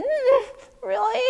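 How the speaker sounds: high-pitched reluctant doubt; dubious dismay. Acted. Man hearing he must bring pig in, dismayed. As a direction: question it in a pained high voice.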